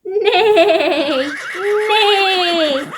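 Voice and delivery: long wavering whinny; horse neigh. Shown as a demonstration. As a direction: imitate a horse's drawn-out neigh. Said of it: "It's a horse". (relincho largo de caballo)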